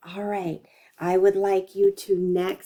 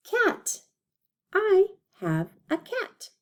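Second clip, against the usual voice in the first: much higher, singsong; high playful voice; 12 semitones higher than usual. Acted. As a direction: say it in a bright, high voice. Teacher's playful example sentence.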